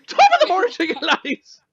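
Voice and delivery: mock Irish accent, high and loud. Mimicking a YouTuber's Irish greeting to friends, hammed-up Irish accent. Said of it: "he doesn't actually have that accent".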